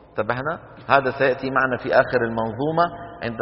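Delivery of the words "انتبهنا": ordinary talk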